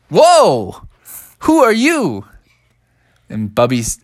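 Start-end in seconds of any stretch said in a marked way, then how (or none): 0.1-2.3 high, louder amazed bear voice; amazed little bear. Acted. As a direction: gasp at the wizard. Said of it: "And Bubby said".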